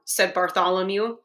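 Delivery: plain reading voice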